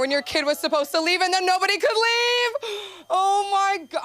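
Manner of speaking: high, loud, near-crying; frazzled parent. Retold or imitated. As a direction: wail it as a fed-up parent. Exasperated covid parent.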